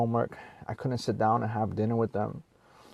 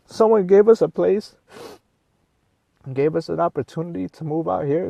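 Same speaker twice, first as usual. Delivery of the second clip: raised and louder, real emotion pouring out; emotional; 5 semitones higher, 4 dB louder than usual. Real, not acted. Father telling viewers how hard it was to find a home, emotional and louder. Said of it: "Sorry if I got a little emotional".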